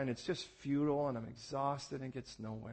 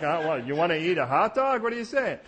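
loud, laughing incredulous reply; mock exasperated parent; 4 semitones higher, 11 dB louder than usual. Dad answering daughter's puppy talk, mock exasperated. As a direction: play the baffled dad. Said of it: "And you're like".

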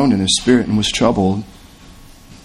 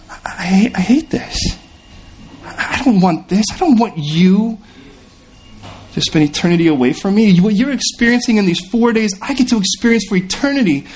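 higher, breaking into a near whisper; anguished Jesus; 7 semitones higher than usual. Acted. Jesus lamenting those who refuse him, higher and anguished. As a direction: plead through the grief. Jesus grieving over lost people.

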